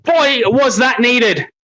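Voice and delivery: relieved shout. Real, not acted. Fan celebrating a long-awaited goal, slow relieved shout. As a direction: shout it out as the tension breaks.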